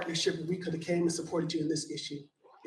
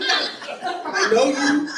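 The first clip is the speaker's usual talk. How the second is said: slow, much higher, laughing through it; laughing greeting; 7 semitones higher, 7 dB louder, much slower than usual. Real, not acted. Public commenter spots a friend in the crowd, laughing. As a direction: laugh warmly as you point someone out.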